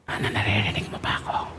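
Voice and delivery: whispered from far off. Retold or imitated. Preacher whispers to the deaf girl from across the room. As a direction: whisper to test her hearing. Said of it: "Lumayo akong malayo".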